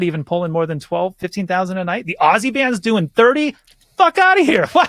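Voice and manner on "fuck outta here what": high-pitched disbelief; incredulous outburst. Acted. Musician hearing what tribute bands earn, incredulous. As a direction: exclaim it like the figures are absurd.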